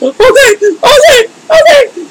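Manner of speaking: high, drawn-out wailing surrender; imitated crying. Storyteller re-enacts giving in to spirit, crying out. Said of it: "And so I cry. I'm like".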